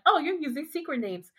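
Tom's voice. Retold or imitated. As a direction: play it like the borrowed Tom bit. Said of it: "I was using the same voice".

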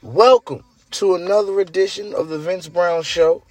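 high, drawn-out announcer welcome; show host intro. Acted. Host welcoming listeners to his show, announcer intro.

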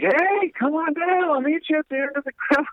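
high, fast, cheery mock voice; high cheery welcome; naked camp guy. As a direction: play the naked mower calling out high and bright. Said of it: "he's just like".

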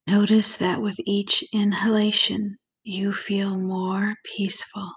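calm, unhurried visualization voice with long pauses; guided meditation. Acted. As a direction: ease the listener gently into relaxation, lingering on key words.

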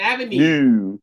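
Avenue drawn out in a mock Baltimore accent; imitating Baltimore accent. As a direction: copy the Baltimore way of saying Avenue. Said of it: "You sound like you from, like, London".

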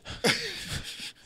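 high unvoiced laugh; breathy laugh. Real, not acted. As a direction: laugh under your breath. Laughing at the joke.

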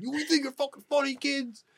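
high-pitched mock angry rant; worked-up scolding, pitched well up; retold angry yelling. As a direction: imitate an irate neighbor yelling at kids. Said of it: "started yelling in my face".

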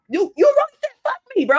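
high, indignant squeal; retold outrage. Confronting the man who left her, outraged. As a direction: go high in exasperated protest.

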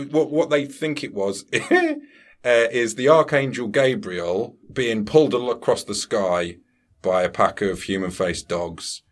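ordinary talk, nothing marked

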